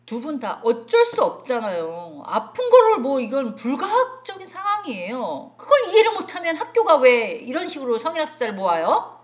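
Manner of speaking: loud, high and indignant; indignant complaint. Retold or imitated. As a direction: protest the unfairness loudly. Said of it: "이러신 분도 계셨어요". (억울하다는 듯 큰 소리로 따지듯)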